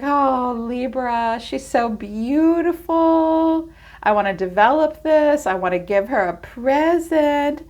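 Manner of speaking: high, dreamy swooning admirer voice; lovestruck admirer. Retold or imitated. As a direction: swoon over her like a lovesick fan. Voicing a smitten admirer.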